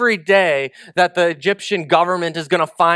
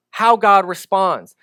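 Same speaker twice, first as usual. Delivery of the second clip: usual speaking voice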